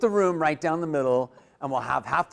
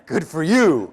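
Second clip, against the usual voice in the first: loud, hammed-up reply; exaggerated listener's retort; mock congratulation; 8 dB louder, slower than usual. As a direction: toss back a big mock cheer. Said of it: "what are they gonna say?".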